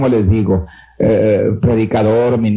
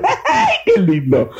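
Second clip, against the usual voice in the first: laughing delight; much slower than usual. Real, not acted. Preacher laughing at the memory.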